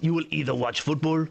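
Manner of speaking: mock-French accent. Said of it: "why I'm doing a French accent".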